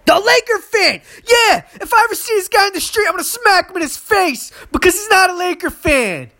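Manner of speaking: angry shouting. Real, not acted. Ranting to listeners about the fan who switched teams, furious and high. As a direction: yell it out, fed up with him. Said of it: "I'm venting and I'm angry".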